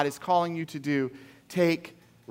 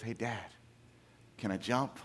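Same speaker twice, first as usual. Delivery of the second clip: quiet ask; 6 semitones lower, 10 dB quieter than usual. Retold or imitated. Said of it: "he goes".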